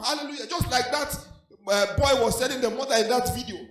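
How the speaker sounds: usual speaking voice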